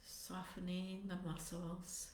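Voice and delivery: soft, unhurried meditation voice with long pauses; guided meditation. Acted. Teacher settling meditators into posture and compassionate motivation, guided meditation. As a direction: ease them gently into stillness, phrase by phrase.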